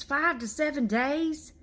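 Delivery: high, slow, incredulous question; outraged disbelief. Real, not acted. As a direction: stretch it out in disbelief. Outraged about period length.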